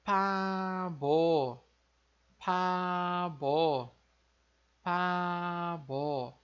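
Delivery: "pabo" com P no início e B no fim; coreano pabo. Shown as a demonstration. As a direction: diga "pabo" devagar, P surdo e B sonoro. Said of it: "a gente vai ler como". (pronúncia coreana de "pabo", devagar)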